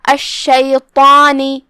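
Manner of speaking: الشیطانی slow, with heavy ṭā and a stretched alif; الشیطانی recited slowly with تفخیم. Shown as a demonstration. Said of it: "مد الف کو بھی تفخیم سے ادا کرنا ہے".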